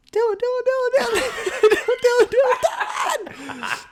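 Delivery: very high sing-song calling of a name; faint distant calling. Retold or imitated. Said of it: "I hear a faint".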